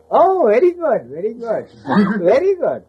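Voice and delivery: high, sarcastic "very good" with a laugh; mock praise. Baba teasing disciples, high mocking praise. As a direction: tease them with exaggerated approval.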